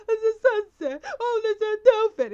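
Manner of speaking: loud, high-pitched comic wail; mock crying. Film character weeping at a sunset, hammed-up sobbing. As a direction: blubber it out, hammed-up and overwhelmed. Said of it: "he starts crying, he's like".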